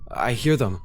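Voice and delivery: ordinary delivery, nothing marked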